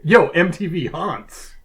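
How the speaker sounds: loud, high, stretched hype call; slow mock hype announcer; show-intro shout.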